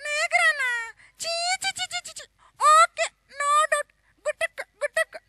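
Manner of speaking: sing-squeal like a shy heroine; high actress voice. Acted. Actress disgusted then agrees.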